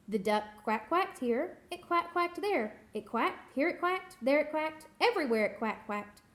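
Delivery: usual speaking voice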